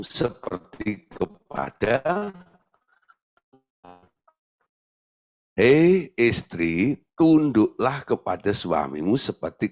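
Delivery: deliberate, drawn-out recitation of the verse; slow, careful reading of Scripture. Shown as a demonstration. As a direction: read it slowly, word by word. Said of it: "kalau baca ayat ini harus melek".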